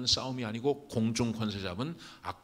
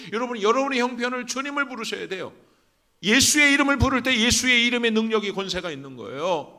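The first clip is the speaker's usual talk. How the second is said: high, loud exhortation; full-force preaching; 11 semitones higher, 11 dB louder than usual. Real, not acted. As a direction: drive it home to the congregation at the top of your voice. (힘차게 외치는 설교)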